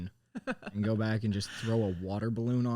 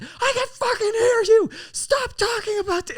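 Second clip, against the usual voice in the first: very high, loud screech; hammed-up shriek from a furious sister; mock enraged yell; 8 dB louder than usual. Imitating sister yelling back through the opened door, screeched. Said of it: "then the door opens. She's like".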